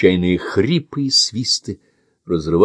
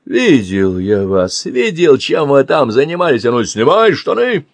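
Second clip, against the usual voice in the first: higher, louder menacing barks; angry threats; 6 semitones higher, 4 dB louder than usual. Acted. Man menaces Andy, angry bark. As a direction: threaten and accuse.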